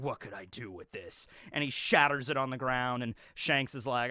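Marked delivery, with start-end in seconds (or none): 0.0-1.3 low, soft, lightly acted scorn; mock disdain. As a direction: imitate a bandit dismissing a gift, pitched down. Bandit scorns the offered rum.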